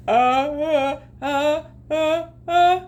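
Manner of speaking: uh-uh-uh building to a sneeze. A narrator's reading. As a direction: gasp up toward a big sneeze. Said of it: "getting ready to sneeze".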